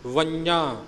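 ਵੰਨਿਆ with a clear nasal n, not ਵੰਜਾ; correct ਵੰਨਿਆ. Shown as a demonstration. Teacher corrects ਵੰਨਿਆ for the sangat. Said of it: "ਵੰਜਾ ਨਹੀਂ".

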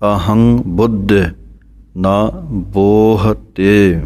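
ਅਹੰ ਬੁੱਧ, then ਨਾ, then a slow ਬੋਹਤੇ; paused phrasing. Shown as a demonstration. Teacher showing students where the pauses fall, slow. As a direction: break the line into separate pieces, stretching ਬੋਹਤੇ. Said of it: "Nanak ਪਦ ਤੇ ਥੋੜ੍ਹਾ ਰੁਕ ਕੇ".

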